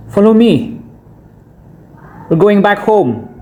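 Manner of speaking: sudden high-pitched cry to get moving; urgent call. A narrator's reading. Father suddenly orders family to flee.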